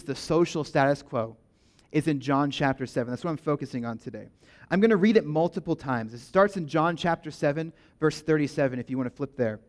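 ordinary talk

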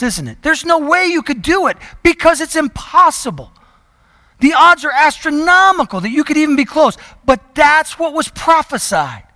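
high, loud preaching voice, building to a climax; forceful preaching. Acted. Preacher hammering home the impossible odds to the church, loud and high. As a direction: proclaim it to the congregation.

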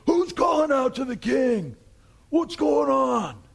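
high, loud, stretched-out shout; yelling back. Acted. Abner wakes up and answers the far hill, shouting. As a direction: holler back across a great distance. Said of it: "He's yelling".

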